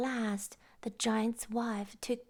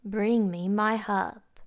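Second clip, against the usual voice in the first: loud booming command; giant's shout; 10 dB louder than usual. A narrator's reading. Giant shouting for his harp after supper, loud. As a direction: bark the order across the table. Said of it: "the giant shouted".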